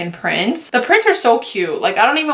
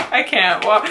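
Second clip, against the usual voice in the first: ordinary talk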